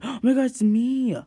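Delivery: excited. Real, not acted. Wins the fashion show.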